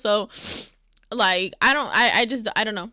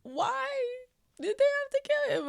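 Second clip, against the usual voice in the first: tearful and hushed; 7 semitones higher, 7 dB quieter than usual. Real, not acted. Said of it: "why do I feel like I'm finna cry even more".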